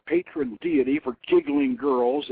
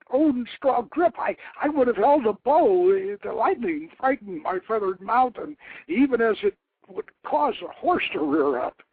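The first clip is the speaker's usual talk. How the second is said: much higher, pleading voice of a frightened warlord; 10 semitones higher, 5 dB louder than usual. Acted. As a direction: beg the goddess for your life. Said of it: "pleading for his life".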